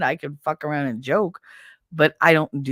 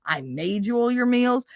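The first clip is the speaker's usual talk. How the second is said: ordinary talk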